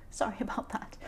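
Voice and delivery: whispered apology with a laugh. Real, not acted. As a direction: laugh it off softly.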